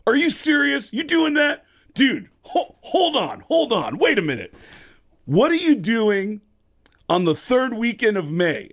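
high, loud, thrilled disbelief; astonished excitement. Retold or imitated. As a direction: stop him short, bursting with amazement. Singer astonished by the prank reveal.

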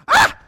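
a sudden high, loud yelp as hair rips out; short sharp cry of pain; imitated yelp of pain. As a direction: yelp out like someone whose hair just got yanked. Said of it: "you just hear 'em yell out".

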